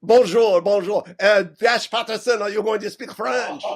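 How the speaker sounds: put-on French accent. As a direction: play a jolly fake Frenchman. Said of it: "use a French accent".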